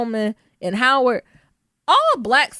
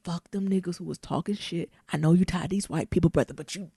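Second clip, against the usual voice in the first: mock whisper; 5 semitones lower, 8 dB quieter than usual. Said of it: "I'll whisper in his ear".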